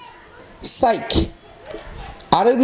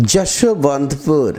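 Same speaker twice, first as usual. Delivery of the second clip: "Yesvantpur" drawn out slowly, syllable by syllable; slow pronunciation demo; 7 semitones lower, much slower than usual. Shown as a demonstration. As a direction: teach "Yesvantpur" slowly, each part sounded. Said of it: "এভাবেই উচ্চারণটা". ("যশবন্তপুর" টেনে ধীরে উচ্চারণ)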